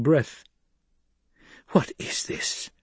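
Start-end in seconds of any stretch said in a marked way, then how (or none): 1.7-2.7 hushed, slow urgent whisper; tense demand. A narrator's reading. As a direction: press for an answer under the breath. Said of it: "Jessica demanded".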